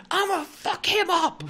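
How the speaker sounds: very high, loud screamed threat; re-enacted screaming. Shouting a threat about a woman's husband, re-enacted screaming. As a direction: scream it as in the story. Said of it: "Like, just screaming".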